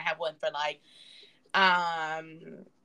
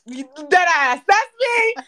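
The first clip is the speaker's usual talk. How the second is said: excited; 9 semitones higher, 11 dB louder, slower than usual. Real, not acted. Excited self-recognition.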